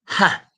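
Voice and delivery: a single sharp scornful "Ha!", high and loud; mocking laugh. Teacher scoffing at mum's letter, sharp mocking laugh. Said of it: "Like that".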